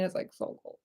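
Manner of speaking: breathy whisper about the cold. Real, not acted. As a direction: whisper it like you're shivering. Describing the freezing freezer.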